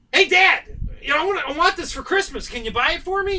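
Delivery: high, loud, whiny plea to dad; begging kid. Retold or imitated. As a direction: beg for a Christmas present like a kid. Teen begs dad for bike.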